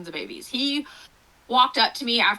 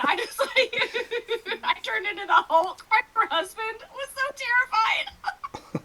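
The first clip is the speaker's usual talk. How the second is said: laughing through the words, higher; 12 semitones higher, slower than usual. Real, not acted. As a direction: let real laughter carry it. Laughing over her own story.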